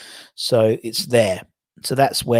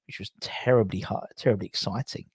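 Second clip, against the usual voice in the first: dry whispered aside, much softer; a whispered ironic joke about how exciting the sponge cakes were; deadpan whisper; 3 semitones lower, 8 dB quieter than usual. Real, not acted. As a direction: drop to a wry murmur.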